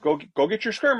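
high, sing-song voice for a dog; playful baby talk to a dog; mock dog-owner voice. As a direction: coax the dog like a pet owner. Talking to a dog.

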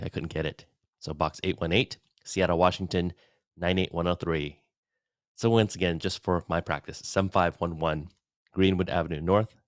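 ordinary talk, nothing marked

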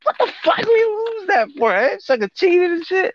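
high-pitched, retold outrage at losing; high indignant complaint, retold; retold angry outburst. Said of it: "I was fucking mad".

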